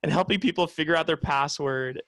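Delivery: mock-groan about being sick of tech support; a touch of retold frustration at work; exasperated inner voice. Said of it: "And I'm like".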